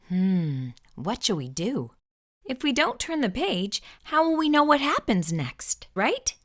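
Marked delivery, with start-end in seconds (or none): none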